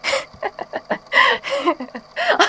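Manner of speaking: high mocking laugh; high amused laugh. Villain mocking the worried host, high mocking laugh.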